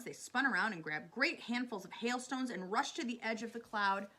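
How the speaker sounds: plain reading voice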